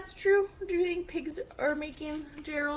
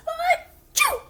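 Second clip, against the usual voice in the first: high, loud achoo; loud sneeze; 13 dB louder than usual. Acted. Gerald sneezing near Piggy, loud achoo. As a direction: let the sneeze burst.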